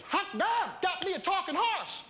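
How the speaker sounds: high-pitched whooping farmer; excited, high mock-farmer outburst; amazed farmer. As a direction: crow with delight at the discovery. Acted amazed farmer in joke.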